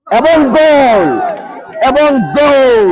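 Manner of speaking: drawn-out cry of goal; excited goal call. Real, not acted. Commentator announcing another shootout goal, excited drawn-out call. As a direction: stretch the last word like a match commentator. Commentator calls a scored penalty.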